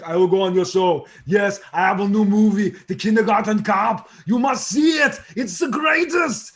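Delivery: put-on loud, high, booming Austrian accent; hammed-up Austrian-accented Arnold; Schwarzenegger impression. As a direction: shout it like Arnold on a talk show. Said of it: "he's like".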